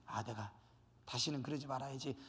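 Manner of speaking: low, soft words to oneself; quietly resolve, chastened, under the voice; repentant murmur. Acted. Acting the sinner's repentant resolve. (나직이 뉘우치듯)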